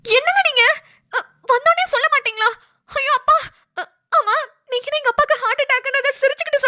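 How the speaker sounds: high, tearful crying wail; crying out in distress to her husband. Acted. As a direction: sob out the words in panic for her father. Wife hears father's heart attack.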